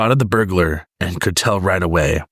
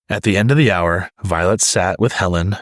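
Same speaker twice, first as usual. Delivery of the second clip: ordinary delivery, nothing marked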